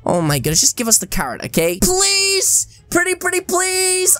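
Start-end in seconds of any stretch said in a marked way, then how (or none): 1.8-4.2 pleading. Real, not acted. Begging rat for carrot.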